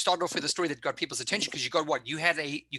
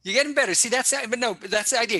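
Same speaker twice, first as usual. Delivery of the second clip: upbeat encouragement; 7 semitones higher, 4 dB louder than usual. Real, not acted. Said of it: "coach encouraging shy student".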